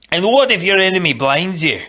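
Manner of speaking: put-on high, loud challenge in Scottish accent; Macpherson. A narrator's reading. Fencing master challenging his pupil, Scottish accent. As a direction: fire the question in a Scots brogue. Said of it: "Macpherson once said".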